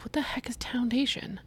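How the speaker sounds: high whispered confusion; unvoiced puzzled whisper. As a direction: whisper it, baffled, to yourself. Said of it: "And I was like".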